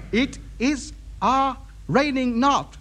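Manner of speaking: slow, word-by-word broken English; long gaps between the words; halting repetition. Acted. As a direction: piece together garbled English as you hear it. Repeats foreigner's broken English word by word.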